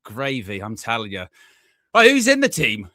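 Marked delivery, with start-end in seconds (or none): 1.9-2.9 loud, high restart; fired-up let's-go question; excited. Real, not acted. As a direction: snap back to business. Excited restart after chaos.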